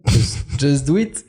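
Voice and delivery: slow, put-on English accent. Shown as a demonstration. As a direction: show off your English accent, slowly. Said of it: "Um, excuse me". (accent anglais appuyé)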